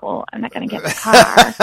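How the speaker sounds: chuckling through the words; amused, half-laughing no; laughing refusal. Retold or imitated. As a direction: laugh at the absurd request as you say it. Retelling her refusal, laughing.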